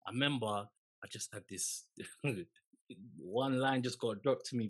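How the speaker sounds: ordinary talk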